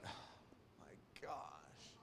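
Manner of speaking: slow, whispered shock; mock dawning realization. Grown child realizing the parents were right, in a slow stunned whisper. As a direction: breathe it out slowly, barely any voice.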